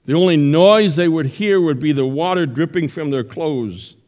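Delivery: usual speaking voice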